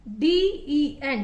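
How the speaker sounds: DEN spelled out very slowly; slow spelling. Shown as a demonstration. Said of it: "ईएन words हैं".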